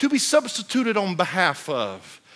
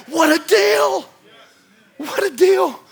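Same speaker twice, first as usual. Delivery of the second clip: preaching at full force; 8 semitones higher than usual. Acted. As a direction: proclaim it to the congregation. Preacher building.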